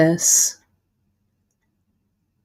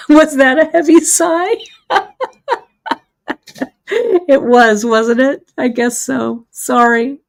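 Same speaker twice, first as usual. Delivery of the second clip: higher, louder, laughing through the words; real amused laughing; 7 semitones higher, 6 dB louder than usual. Real, not acted. Crafter caught sighing on her broadcast, laughing. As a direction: giggle while owning up to it. Amused at own heavy sigh.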